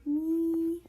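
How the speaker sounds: Me stretched out loud and slow, the sound in meow. Shown as a demonstration. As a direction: sound out Me clearly. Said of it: "like the word me".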